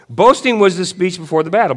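loud, high-pitched proclamation; worked-up declaration. Real, not acted. Preacher restarts his point about battle boasts, worked up and loud. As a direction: belt it out as a battle announcement.

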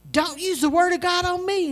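loud, high, furious snap from mom; angry retort. Retold or imitated. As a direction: fire it back at her, full anger. Mom snaps back on phone.

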